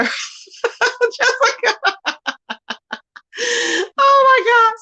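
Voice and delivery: high, cracking laugh. Real, not acted. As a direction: laugh through the words. Laughing hard at chat.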